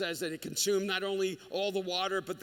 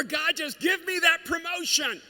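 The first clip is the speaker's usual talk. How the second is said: much higher, louder and slow, crying out to God; shouted pleading prayer; 9 semitones higher, 7 dB louder than usual. Retold or imitated. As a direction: beg at the top of your voice. Imitating a prayer for a promotion.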